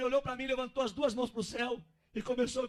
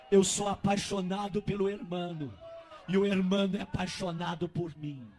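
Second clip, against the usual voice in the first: devagar e com ênfase forte; declaração solene; 3 semitones lower, 7 dB louder, slower than usual. Acted. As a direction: declare o amor pausadamente, com peso. Voz encenada do Espírito Santo. (voz solene do Espírito)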